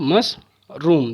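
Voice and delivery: "Mushroom" in two beats, मश, pause, रूम; a clear break midway through "mushroom"; split into syllables. Shown as a demonstration. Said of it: "दो बार में". ("Mushroom" को मश और रूम में तोड़कर)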